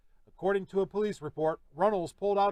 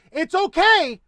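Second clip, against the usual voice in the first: much higher, louder mocking shout; sarcastic shout; 11 semitones higher, 11 dB louder, slower than usual. Real, not acted. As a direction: yell it back in scorn. Sarcastic rant, bursts out.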